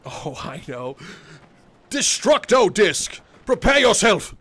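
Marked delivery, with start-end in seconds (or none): 1.9-4.3 loud, high battle incantation; wizard casting spells. Acted. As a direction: hurl the spell out like a grand sorcerer.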